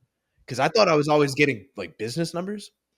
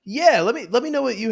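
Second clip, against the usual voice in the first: excited; 12 semitones higher, 7 dB louder than usual. Real, not acted. Excited reply, high and loud.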